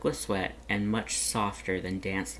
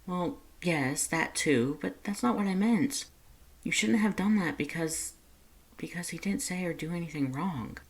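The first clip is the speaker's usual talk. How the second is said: higher voice, pressing to correct him; Lust, urgent; 8 semitones higher than usual. A narrator's reading. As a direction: insist he misunderstood, voice up high. Lust voiced, upset, correcting Dance.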